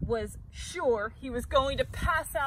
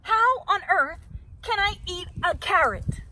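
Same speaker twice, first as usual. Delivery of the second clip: loud, high exasperation; exasperated shout; 5 semitones higher, 4 dB louder than usual. A narrator's reading. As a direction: throw up your hands. Bird shouting at Rabbit.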